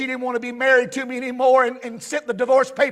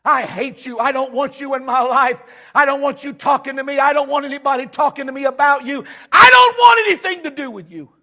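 mock scream building to a loud peak; retold rage rising line by line; 5 semitones higher, 8 dB louder than usual. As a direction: start contained, climb to an imitated shout. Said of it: "began to scream at the top of my lungs".

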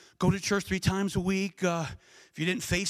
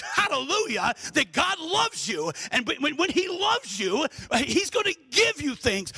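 full-force preaching; 7 semitones higher, slower than usual. Acted. Preacher tells his church God loves them, full-force preaching. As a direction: build the sermon to a shout.

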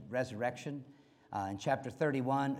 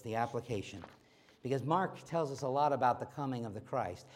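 ordinary talk, nothing marked

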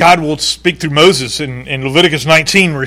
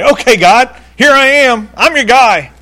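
eager volunteer; 8 semitones higher, 4 dB louder than usual. Pretend. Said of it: "I'd probably be tempted to say".

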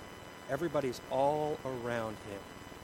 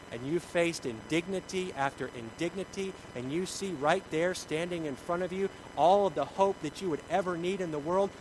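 normal talking voice

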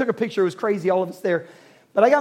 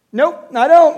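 put-on louder, higher kid's flat refusal; a hint of a boy's blunt no; son's firm nope; 6 semitones higher, 10 dB louder than usual. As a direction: play the son refusing outright. Son voiced answering in story.

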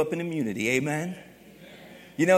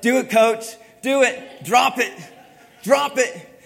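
louder, higher chanting of teammates egging him on; teammates shouting; 5 semitones higher, 7 dB louder, slower than usual. Retold or imitated. Wrestlers urging coach to drop weight, shouting. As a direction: yell it like a wrestling room crowd. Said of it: "other wrestlers are like".

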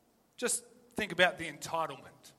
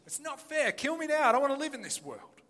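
higher, whiny, sulky plea; whining Jonah; 7 semitones higher than usual. Retold or imitated. Preacher voicing Jonah sulking at God, higher and whiny. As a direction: play a sulking prophet begging to die. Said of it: "That's what he says".

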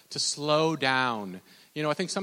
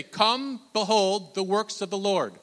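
high, ringing call to the congregation; emphatic pulpit call; preach; 6 semitones higher, 5 dB louder, slower than usual. Acted. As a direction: proclaim it for everyone to repeat. Leads congregation in verse.

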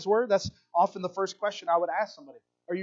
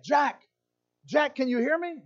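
higher, acted distant hail; calling out; 7 semitones higher than usual. Voice from above calling to Jack on the cliff, hailing. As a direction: call down to someone stranded. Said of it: "he heard a voice back".

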